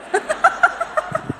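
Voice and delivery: breathy high burst of laughter. Real, not acted. Preacher laughing at the replayed fight, very high.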